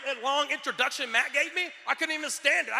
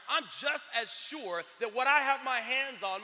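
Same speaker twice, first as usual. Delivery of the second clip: ordinary delivery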